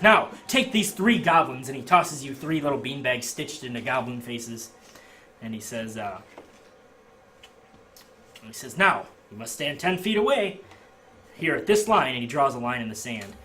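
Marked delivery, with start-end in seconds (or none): 0.0-1.7 slow, louder and higher; barker. Acted. Barker handing beanbags. 8.8-12.1 higher, louder instruction; barker. Acted. Barker giving rules.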